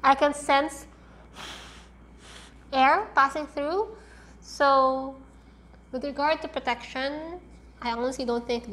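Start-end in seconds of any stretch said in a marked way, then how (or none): 0.0-5.2 muffled behind cloth mask; speaking with mask on; through a face mask. Real, not acted.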